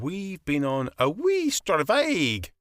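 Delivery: slow, sly hint of a Scots accent; sneaky Scottish. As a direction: slip the Scots in slowly. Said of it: "trying to sneak a bit of Scottish in".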